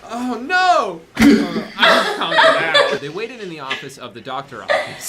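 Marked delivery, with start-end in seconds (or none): 0.0-1.0 sudden loud dismay, pitched up; shocked oh no. Real, not acted. Reacting to shameful fanfic. 1.2-3.0 high, loud laugh through the words. Real, not acted. Laughing while reading.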